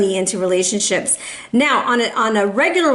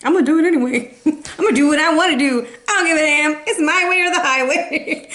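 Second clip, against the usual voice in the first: stubborn, defiant, higher, laughing; defiant mock voice; 4 semitones higher than usual. Mock defiance aimed at fire signs ignoring advice. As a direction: brat who won't listen. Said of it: "You're like".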